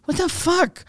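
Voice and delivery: very high, exasperated outburst; mock-outraged high pitch; retold anger. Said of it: "So I'm super angry".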